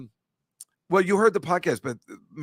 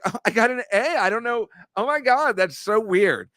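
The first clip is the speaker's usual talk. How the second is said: much higher, mock-surprised girly voice; mock straight-A girl; 7 semitones higher than usual. Host mocks Catalina as the modest top student, high girly voice. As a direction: play the falsely modest student, squealing in fake disbelief. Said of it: "you're one of those people that says".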